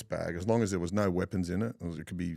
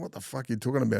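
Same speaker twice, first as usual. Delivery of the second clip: higher-pitched, retold angry challenge; mock aggressive; 6 semitones higher, much faster than usual. Screw riled up over a mankini rumour, mock aggressive. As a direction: imitate someone squaring up, not full force. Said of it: "he's really aggressive to him".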